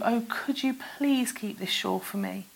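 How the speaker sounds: whispered, begging plea; girl begs the trader to keep the shawl, whispering; pleading whisper. A narrator's reading. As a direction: beg the storekeeper in a hushed whisper. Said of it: "she pleaded".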